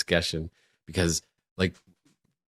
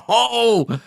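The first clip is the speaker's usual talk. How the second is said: high, loud retold astonishment; amazed; 13 semitones higher, 7 dB louder than usual. Viewer reacting to a landmine stunt, amazed. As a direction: wonder aloud in an excited high voice.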